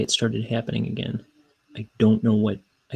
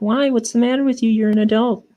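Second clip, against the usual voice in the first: high-pitched imitation of a scolding child; put-on kid voice, incredulous; exasperated kid; 12 semitones higher than usual. Kids scolding their clueless uncle, mock exasperated child voice.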